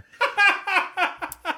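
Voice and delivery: squealing laugh at own impression; laughing high and breathless; high burst of laughter. Real, not acted.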